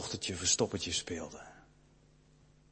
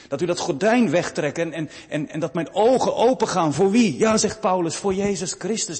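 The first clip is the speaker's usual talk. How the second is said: raised, higher pulpit voice; fervent preaching; 7 semitones higher, 9 dB louder than usual. Acted. Preacher pleading with the congregation, louder in fervent preaching. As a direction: press the point on the whole church.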